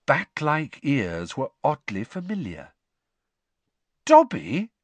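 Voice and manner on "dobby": sharp, high, loud disbelief; incredulous. A narrator's reading. Harry spots what looks like Dobby, incredulous. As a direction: blurt it out as a startled question. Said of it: "said Harry incredulously".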